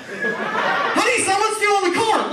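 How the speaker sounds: high, urgent household holler; mock a wife shouting about an emergency; loud, high-pitched call of alarm; wife yelling upstairs. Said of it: "The wife will yell upstairs".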